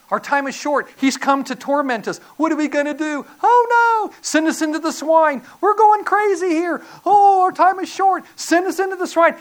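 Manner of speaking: high, loud mock panic, building to wails; frantic demons. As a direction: cry out like demons running out of time. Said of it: "these demons know".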